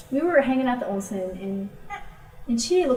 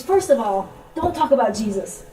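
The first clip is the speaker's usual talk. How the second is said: retold firm instruction; 3 semitones higher, 8 dB louder than usual. Friend setting the missionary straight on sharing, emphatic. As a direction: lay down the rule forcefully.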